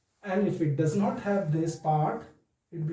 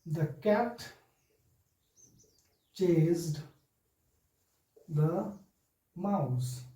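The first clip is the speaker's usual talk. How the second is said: slow example dictation; much slower than usual. Shown as a demonstration. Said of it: "I'll give you example".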